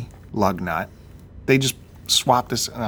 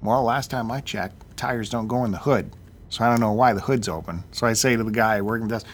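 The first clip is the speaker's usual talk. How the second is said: normal talking voice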